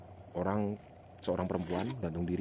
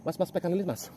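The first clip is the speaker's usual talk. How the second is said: higher, louder call to the crew; urgent call; 11 semitones higher, 6 dB louder than usual. Real, not acted.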